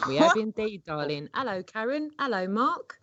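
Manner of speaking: hammed-up British. Guest greets hosts in a hammed-up British voice. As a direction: ham up the British charm. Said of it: "now you're just ham up".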